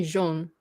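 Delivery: jaune with a rounded French O; French jaune, soft zh and pure O. Shown as a demonstration. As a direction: say jaune as "zhohn". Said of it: "A U is also pronounced O".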